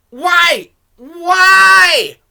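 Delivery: loud, high, disbelieving yell; real frustrated cry of why; exasperated shout. Real, not acted. Exasperated at the movie's logic.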